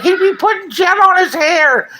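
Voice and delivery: high, loud mocking quote of the haters; mocking gossip voice. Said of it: "They'd be like".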